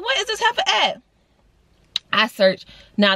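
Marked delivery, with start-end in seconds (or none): 0.0-1.0 fed-up question at the cat, pitched high; exasperated. Retold or imitated. Annoyed at hiding cat.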